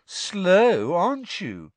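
loud, high, gleeful tease; mock him cheerfully; cheeky ghost girl; teasing. A narrator's reading.

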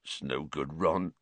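a big man giving up, heavy-hearted; sad. Acted. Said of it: "said Hagrid sadly".

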